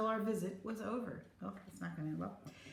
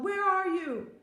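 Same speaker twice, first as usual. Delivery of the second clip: high, loud searching call; 11 semitones higher, 6 dB louder, slower than usual. A narrator's reading. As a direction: shout into the distance for a lost child. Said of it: "she called".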